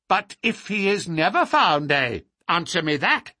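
put-on high, testy old man; crotchety old man's challenge; querulous old lord, pressing. A narrator's reading. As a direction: press for an answer peevishly.

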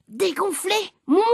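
high, offended echo of "Dégonflé", rising as a question; indignant cry. A narrator's reading. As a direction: throw "Dégonflé" back in disbelief. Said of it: "a crié papa".

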